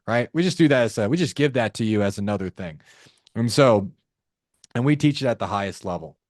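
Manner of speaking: ordinary talk, nothing marked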